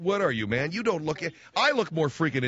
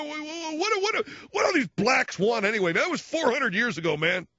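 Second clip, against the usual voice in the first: high, agitated mock rant; mocking a worked-up ignorant buddy; 12 semitones higher than usual. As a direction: sputter into an indignant outburst, high-pitched.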